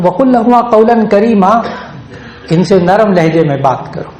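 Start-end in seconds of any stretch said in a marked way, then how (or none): 0.0-1.5 fast, high recitation of the verse; Quranic recitation. Acted. (تیز اور اونچی آواز میں تلاوت)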